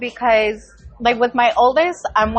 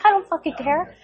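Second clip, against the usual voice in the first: higher-pitched mock irritation; retold defiant retort; 6 semitones higher than usual. Mom answering son about being on speaker, mock defiant. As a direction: throw it back, pitched up.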